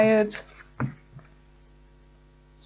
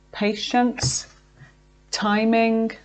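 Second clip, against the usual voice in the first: ordinary talk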